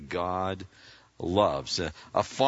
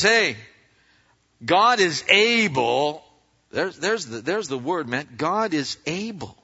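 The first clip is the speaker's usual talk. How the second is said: fervent preaching; 5 semitones higher than usual. Real, not acted. Preacher's emphatic climax.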